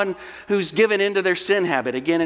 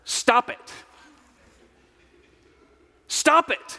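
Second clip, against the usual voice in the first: pitched-up yell at half volume; retold yell; 9 semitones higher, slower than usual. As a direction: act out the doctor's sudden order. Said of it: "he yelled at her".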